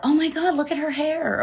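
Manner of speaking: high-pitched mock judgy gasp; judgy little voice in the head; judgy inner voice. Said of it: "that little judgy voice".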